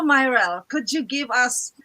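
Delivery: ordinary delivery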